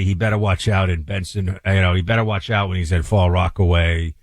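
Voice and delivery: a tough New York accent warning Clay about Staten Island. Shown as a demonstration. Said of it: "I was switching on purpose".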